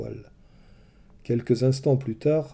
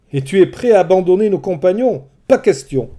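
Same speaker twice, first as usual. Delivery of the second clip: higher, louder indignant outburst; furious refusal; angry; 7 semitones higher, 9 dB louder than usual. Acted. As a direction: refuse to abandon the crew. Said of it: "Ulysse se mit en colère".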